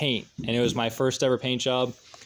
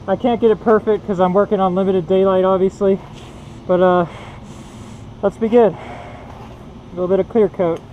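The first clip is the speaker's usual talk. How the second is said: muffled behind a full face mask; talking through a mask; 3 semitones higher, 4 dB louder than usual. Real, not acted. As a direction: voice dulled by a painting mask. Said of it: "I'm talking through a mask".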